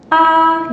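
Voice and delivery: "ah" held high and level; first tone. Shown as a demonstration. Teacher showing the first tone, "ah" high and level. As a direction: sustain "ah" on one high note. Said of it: "datar dan tinggi".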